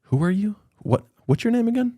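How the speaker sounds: high, mock-puzzled brush-off; dismissive. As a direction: pretend you never met them. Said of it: "It's like".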